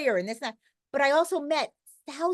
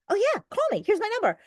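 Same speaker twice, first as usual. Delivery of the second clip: eager offer; 10 semitones higher, faster than usual. Retold or imitated. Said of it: "And I'm like".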